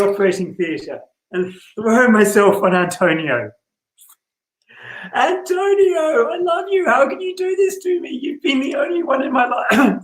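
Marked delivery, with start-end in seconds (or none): 5.1-10.0 much higher, breaking voice in a desperate declaration. Acted. Cristiano begging Antonio not to betray him, voice breaking. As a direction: plead like a heart torn open, voice cracking. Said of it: "Sorry, my voice is breaking".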